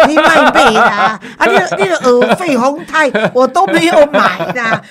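laughing while talking. Real, not acted. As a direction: chuckle through the words. Laughing at co-host's remark.

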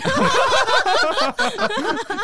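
loud, high over-the-top cackle; exaggerated laugh. Real, not acted. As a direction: burst into a showy laugh. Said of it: "你的笑声是这样吗". (浮夸的大笑)

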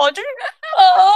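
slow, high, loud laughing cry; loud wail-laugh. Real, not acted. Recalling how sad she got, laughing loud. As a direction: drag out a half-laugh, half-wail. Cries out remembering the sadness.

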